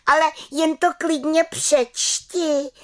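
high, slow defiant little-witch cry; exclaimed. A narrator's reading. Little witch daring aunt Bimbula to read, high defiant cry. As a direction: dare her to read it, high and drawn out. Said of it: "vykřikla malá čarodějnice".

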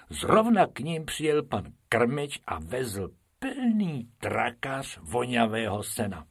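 plain reading voice

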